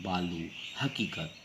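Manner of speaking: ordinary delivery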